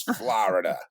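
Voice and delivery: drawn "Florida" as FLAH-rida; mimicked cartoon "Florida" with a broad "ah"; child imitating Marty. Dad retells his child saying "Florida" like the manatee, FLAH-rida. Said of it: "It sounds like Marty".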